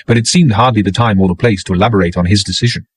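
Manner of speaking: usual speaking voice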